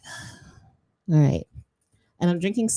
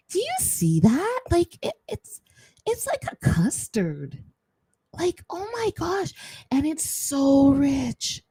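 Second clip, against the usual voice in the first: high, slow amazed gushing; 9 semitones higher, slower than usual. Real, not acted. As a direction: gush over the product's texture. Marvelling at shea butter.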